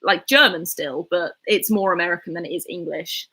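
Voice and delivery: ordinary talk, nothing marked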